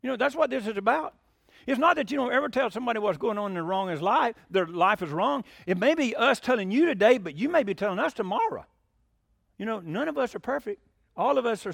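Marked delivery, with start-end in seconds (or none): none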